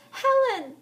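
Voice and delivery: loud high shout into the rain; calling out. Acted. Heather calling Helen across the pond. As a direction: call her back across the pond. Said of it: "she called out".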